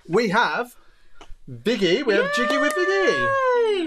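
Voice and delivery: playful announcement. Acted. Host announces guest playfully.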